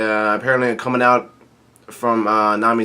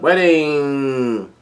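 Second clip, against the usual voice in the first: drawn-out mock holler; retold yell; 3 semitones higher, 3 dB louder than usual. Narrator retelling Big Mom's call, retold yell. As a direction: call it out with a long held ending. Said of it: "yells out".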